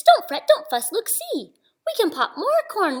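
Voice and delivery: high squeaky mouse comforting. Acted. Mouse comforting the crying Bear, high and squeaky.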